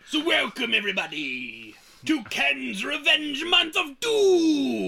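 slow, loud booming announcer. Acted. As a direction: announce it like a villain show host. Announcing a podcast theme.

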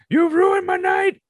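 slow, high, loud mock outrage; entitled customer whining, hammed up; mock outraged customer. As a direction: ham up a diner whose night is ruined. Mocking a demanding customer.

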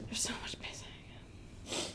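slow hushed whisper. Real, not acted. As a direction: breathe it as a secret. Aside about films with pissing.

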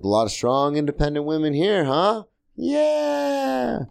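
rising, over-eager crowd pleaser; pandering. Retold or imitated. Comic retells working a women's crowd, pandering. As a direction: fish for cheers, ending on a high cheerleading yeah. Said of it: "I just kept pander, pander, pander".

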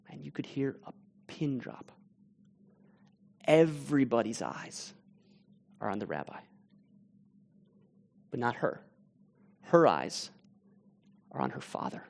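very soft, half-whispered storytelling; hushed narration. A narrator's reading. Preacher hushing the congregation to describe the stunned silence. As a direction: draw listeners into the silent room.

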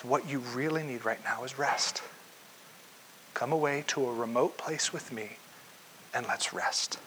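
Jesus softly inviting tired disciples away to rest; tender invitation. Acted. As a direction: invite them close, quietly.

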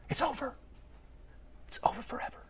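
fading down to an unvoiced whisper on the last words. Preacher to congregation, fading into a hushed whisper. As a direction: let it die away to a breath.

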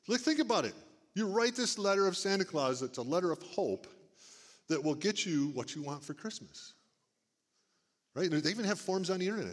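ordinary talk

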